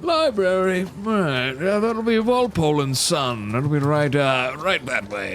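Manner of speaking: a hint of an eager local; NPC townsperson voice. GM voices townsperson giving directions.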